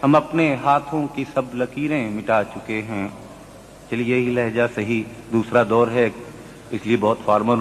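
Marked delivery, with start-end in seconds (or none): 0.0-3.1 poetry recitation. Acted. Mushaira recitation.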